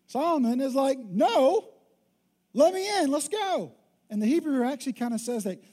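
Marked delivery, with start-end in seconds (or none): 1.0-3.7 much higher, louder no and let me in; insistent mock plea. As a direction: refuse to take no for an answer. Solomon undeterred at the door.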